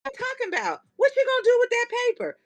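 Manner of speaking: very high, fast incredulous squeal; mock the friend who can't believe it; baffled friend. Friend questioning the paper, squeaky mock disbelief. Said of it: "They're like".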